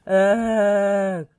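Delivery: loud strained growl; tired steamroller growling. Acted. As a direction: grunt in weary frustration. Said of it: "growled Rolly".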